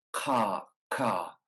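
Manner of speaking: a crisp ka made at the back of the mouth; tongue back for ka. Shown as a demonstration. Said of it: "at the back of the mouth".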